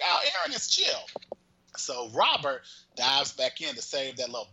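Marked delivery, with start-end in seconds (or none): 0.0-1.1 mock relief. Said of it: "And so you think".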